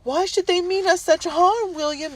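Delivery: high, loud, distressed wife; wife crying to her husband about the mob; frightened cry. A narrator's reading. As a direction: cry out in fear. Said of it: "Mistress Hewson cried".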